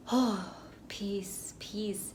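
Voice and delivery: breathy whispered sigh of relief; relieved whisper. Retold or imitated. Exhausted after work, whispering relief at home. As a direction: whisper it like finally sinking onto the couch.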